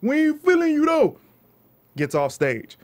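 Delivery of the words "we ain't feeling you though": high, loud jeering boo; crowd booing. Retold or imitated. Audience booing the comic, high and loud. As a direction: heckle him off the stage.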